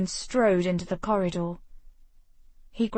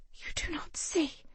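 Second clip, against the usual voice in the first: urgent unvoiced whisper, pitched up; 9 semitones higher, 9 dB quieter than usual. A narrator's reading. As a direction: whisper it tensely, as a secret. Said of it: "She wrenched free and whispered".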